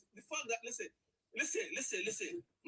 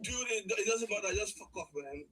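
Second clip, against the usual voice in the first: ordinary talk, nothing marked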